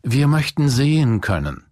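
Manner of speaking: höher und lauter gebeten; flehend. Acted. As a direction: bitte inständig, mit gehobener Stimme. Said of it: "flehten ihn die Blinden an". (hohes, lautes Flehen)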